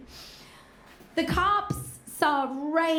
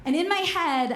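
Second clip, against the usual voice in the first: ordinary delivery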